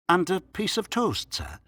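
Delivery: high, questioning landlady; landlady's suggestion. A narrator's reading. Landlady suggesting toast to the doctor, high and deferential.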